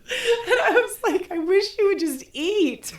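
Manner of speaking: high, slow laughing plea. Real, not acted. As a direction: laugh through the words. Laughing while quoting herself.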